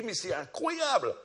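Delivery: high, loud, falling on incroyable; the student marvelling aloud; amazed cry. Retold or imitated. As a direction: exclaim in wonder.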